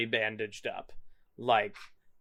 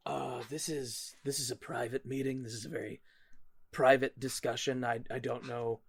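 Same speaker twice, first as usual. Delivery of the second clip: hushed wary voice; 3 semitones lower, 8 dB quieter than usual. Acted. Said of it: "and they go".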